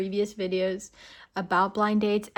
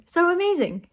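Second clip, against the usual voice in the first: higher, excited lift on so amazing; gushing emphasis; 9 semitones higher than usual. Real, not acted. Host praising the woman's reaction, gushing excitedly. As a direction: gush over it. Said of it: "her reaction is just so".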